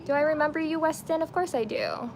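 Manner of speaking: usual speaking voice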